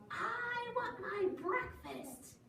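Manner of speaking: high, drawn-out chick voice; high sing-song demand; little yellow chick. Acted. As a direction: plead slowly like a baby chick. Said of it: "said the little chick yellow".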